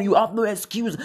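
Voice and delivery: normal talking voice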